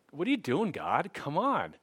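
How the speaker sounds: retold "oh come on" frustration; exasperated plea. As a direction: plead in playful frustration, pitch swooping up. Retold complaint to God.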